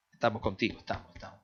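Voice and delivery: lightly voiced, trailing-off reassurance; mock pat on the back. Preacher imitating shallow friends, fading mock reassurance. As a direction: fade out into empty repetition. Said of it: "Es el de la palmadita".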